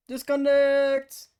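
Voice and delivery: loud slow call; loud drawn out. Real, not acted. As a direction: belt it out slowly. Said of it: "that tone of voice".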